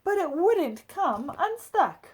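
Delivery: expressive read-aloud storytelling; picture book narration. A narrator's reading. Adult reading a picture book to children, lively storybook voice. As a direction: tell it like a bedtime story for kids.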